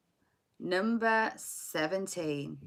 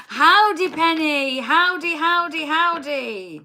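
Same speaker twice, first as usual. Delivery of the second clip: loud, high mock Southern howdy; hammed-up Southern cowgirl greeting, slow; Southern accent; 10 semitones higher, 11 dB louder, slower than usual. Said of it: "It's the accent, isn't it?".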